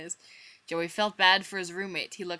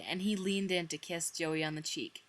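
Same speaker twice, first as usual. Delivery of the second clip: plain reading voice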